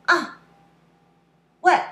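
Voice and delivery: short, loud, sharply falling bursts; mock angry. Shown as a demonstration. Teacher shows why fourth tone sounds angry, mock-angry outburst. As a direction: fire it off high and abrupt, as if angry. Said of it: "it sounds like angry".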